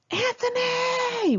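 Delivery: high, drawn-out call into the dark house; screaming a name. Retold or imitated. Friends screaming for their roommate after the quake. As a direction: yell for a friend who might be hurt. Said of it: "we screamed".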